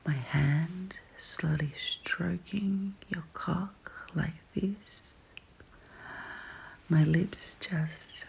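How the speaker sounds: slow, close whisper in the ear; right in your ear. Acted. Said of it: "My voice in your ear like this".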